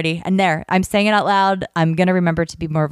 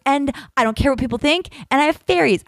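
much higher, defiant and giddy; squeaky triumphant brag; proud declaration; 10 semitones higher than usual. Retold or imitated. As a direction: announce it like a victory. Triumphant strut out of church.

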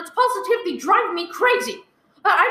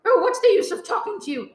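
normal talking voice

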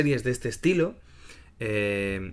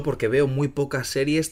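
ordinary talk, nothing marked

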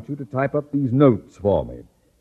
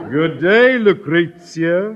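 laughing, louder, drawn out; gloating laugh; 5 semitones higher, 6 dB louder, slower than usual. Acted. Principal mocking Miss Brooks as he dismisses her, laughing.

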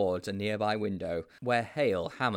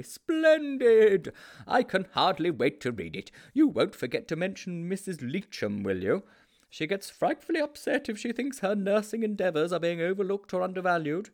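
much higher, louder gushing headmaster; delighted headmaster; 10 semitones higher, 6 dB louder than usual. A narrator's reading. The headmaster praises the boys' newspaper piece, gushing. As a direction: gush with delight.

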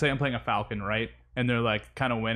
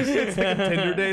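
laughing; 7 semitones higher than usual. Real, not acted. Repeated joke line.

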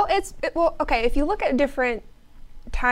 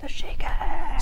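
whisper; much slower than usual. Acted. Co-host echoes word in aside.

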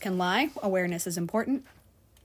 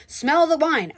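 rude customer; 7 semitones higher, 7 dB louder than usual. Retold or imitated. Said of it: "rude customer insisting".

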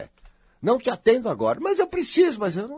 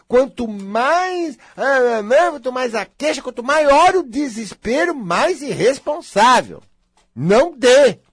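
raised, drawn-out warning, louder and higher; fervent preaching; 7 semitones higher, 7 dB louder, slower than usual. Real, not acted. Preacher warning listeners against pampering, loud and slow. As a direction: hammer home the danger slowly and forcefully.

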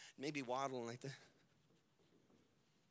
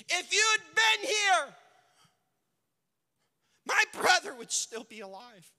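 grieving reproach; 10 semitones higher, 11 dB louder than usual. Acted. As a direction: wail the accusation at Jesus.

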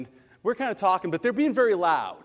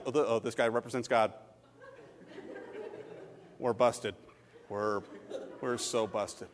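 lower, softer guilty aside; sheepish guilty mutter; 5 semitones lower, 7 dB quieter than usual. Retold or imitated. Rowdy guys caught by the pastor, sheepish low mutter. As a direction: mumble like you got caught.

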